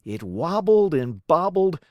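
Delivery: plain reading voice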